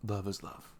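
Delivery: hushed, close whisper to a partner; ASMR whisper. Acted. Boyfriend whispering his thoughts to his girlfriend in bed. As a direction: murmur it close to her ear.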